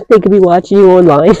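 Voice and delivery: talking through a laugh; amused, laughing delivery; real laughter. Real, not acted. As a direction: crack up while getting the words out.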